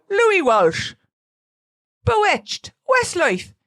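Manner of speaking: high, loud, hammed-up Irish accent. Shown as a demonstration. Podcaster demonstrating her warm-up phrases, Irish accent. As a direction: punch out each name in showy Irish. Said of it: "I'm doing an Irish accent".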